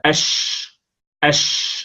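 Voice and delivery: أش whispered as a voiceless hushing sh; teacher sounding out shin; shin sound demo. Shown as a demonstration. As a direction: hiss أش with no voice. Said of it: "الشين هكذا".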